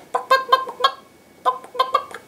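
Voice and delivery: very high, short staccato clucks; chicken clucks. Pretend. Teacher giving children a farm sound to guess, chicken clucking. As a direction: cluck like a hen in quick little bursts. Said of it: "I hear a chicken".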